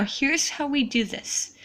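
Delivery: ordinary delivery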